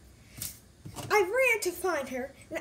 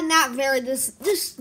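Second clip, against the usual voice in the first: normal talking voice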